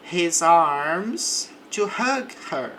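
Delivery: deliberate teacher pace; slow example read. Shown as a demonstration. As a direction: read it slowly for repetition. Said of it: "English example read slowly".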